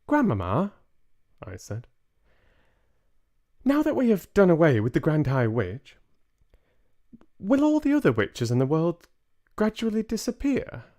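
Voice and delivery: put-on high, quick small boy's voice; a little mouse boy chattering fast; young boy mouse. Boy mouse tells grandmother of their future, high and quick.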